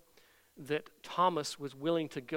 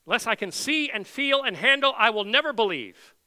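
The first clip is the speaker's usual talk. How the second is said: much higher and louder, never hammered hard; emphatic Thomas; 6 semitones higher, 10 dB louder than usual. Retold or imitated. Preacher crying out Thomas's refusal to believe, loud and high. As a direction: preach the refusal out at full force. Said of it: "Rather emphatic".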